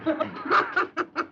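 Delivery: high mocking burst of laughter. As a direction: laugh at the lump of clay. Mocking the sculpted hand.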